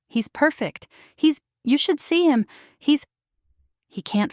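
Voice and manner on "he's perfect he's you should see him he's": higher, cracking voice, trailing off; voice breaking. A narrator's reading. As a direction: choke up, unable to finish. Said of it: "voice breaking".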